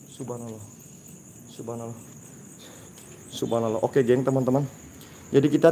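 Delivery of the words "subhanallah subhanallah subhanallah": very soft, low murmur; hushed invocation. Real, not acted. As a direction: breathe it out under your breath, low. Murmured awe during ghost hunt.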